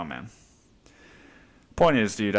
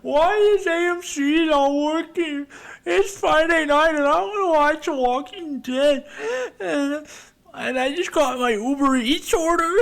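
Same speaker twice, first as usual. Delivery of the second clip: put-on much higher, slow, whining baby voice; whiny caller. Viewer whines to customer support about the static, slow high whine. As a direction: whimper the complaint like a big baby. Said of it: "they're like".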